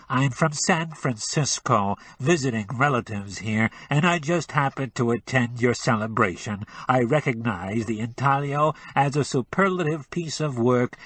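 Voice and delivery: pinch the sound into the nose; nasal. Acted. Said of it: "with an adenoidal twang".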